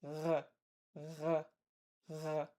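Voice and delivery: throaty R, rasped at the back; r rubbed against the uvula; uvular fricative r. Shown as a demonstration. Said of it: "So it sounds like this".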